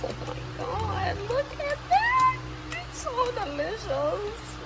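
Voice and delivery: delighted gushing. Real, not acted. Marvelling at the crema.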